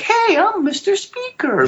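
very high, slow cartoon voice; squeaky falsetto sales pitch, played for laughs; Mickey Mouse. Shown as a demonstration. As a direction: put on a high, drawn-out cartoon mascot voice. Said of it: "put on my Mickey Mouse voice".

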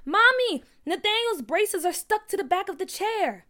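high, loud tattling cry to mother; alarmed child's shout. A narrator's reading. Sister alerts mother to stuck braces, alarmed shouting. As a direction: call for Mommy in alarm.

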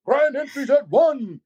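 high, drawn-out announcer call; powwow emcee. Retold or imitated. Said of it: "a powwow M C or announcer".

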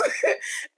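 soft airy laugh; a quiet giggle; breathy giggle. Real, not acted. Laughing about her mess.

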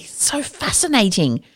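soft, slow, hushed amazement; quiet awe. Real, not acted. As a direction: breathe it out in wonder. Awed reaction to the case.

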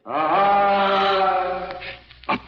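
high, echoing through a rock chimney. Acted. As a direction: call it down a hollow shaft.

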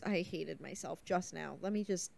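usual speaking voice

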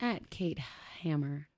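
name fading to a whisper on Hammer; unsure of her own handle, voice drops away; trailing off unsure. Real, not acted. Reading her handle, unsure, voice fading out. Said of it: "I'm unsure if it's Kate Haha Hammer".

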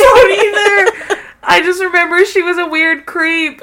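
high, loud laughing outburst; cracking up. Real, not acted. Agreeing with co-host she forgot it all, cracking up. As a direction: squeal it out through real laughter. Cracking up, admits same forgetfulness.

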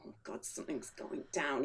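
low, hushed inner voice; worried undertone. Retold or imitated. Diner noticing trouble with the staff, worried undertone. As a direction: think it aloud, low and very soft.

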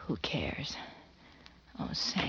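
seductive whisper. Acted. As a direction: whisper it, close and intimate.

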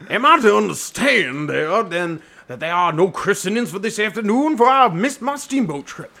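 high-pitched put-on clergyman accent; hammed-up Doctor Chasuble.

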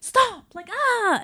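high-pitched mock exasperated outburst; came in hot. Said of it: "I came in hot".

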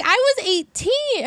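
high, slow disbelief; stunned she was that age then; incredulous. Real, not acted. Cohost recalling her own age, high incredulous.

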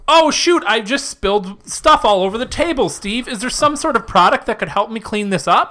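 high, hammed-up helpless customer; infomercial stooge. Customer who spilled stuff asking Steve for a product, hammed-up. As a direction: set up the pitch with mock dismay.

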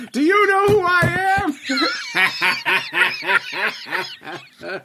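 mock outraged reviewer threatening the company; pompous mock threat. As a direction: puff yourself up like an entitled customer.

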